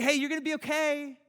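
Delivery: high, loud sing-song comforting; hammed-up cheerful pep talk; mock cheery reassurance. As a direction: play the chirpy friend who brushes pain off. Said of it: "It's like".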